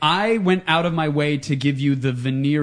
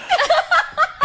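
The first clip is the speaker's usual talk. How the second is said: high squealing laugh. Acted. After whispered gossip quote.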